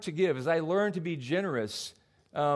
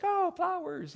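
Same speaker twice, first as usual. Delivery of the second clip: much higher, airy delight at flowers; delighted wife; slower than usual. Retold or imitated. Preacher imitates his wife noticing flowers, high and delighted. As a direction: sigh it out like a dreamy wife. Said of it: "she's kinda like".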